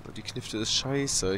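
normal talking voice

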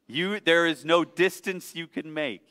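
ordinary talk, nothing marked

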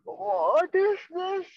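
high and drawn out, slowly realising; slow, high frightened question; slow dawning fear. Acted. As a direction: stretch it as the horror sinks in. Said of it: "And he's like".

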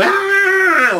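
high, loud shriek of fright; woman's terrified scream. Retold or imitated. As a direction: scream like you've just met the elephant. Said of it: "And the woman go".

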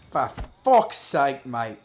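a bad put-on British accent for laughs. Streamer hamming up a British accent, slow loud mock curse. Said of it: "Worst British accent ever?".

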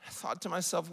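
normal talking voice

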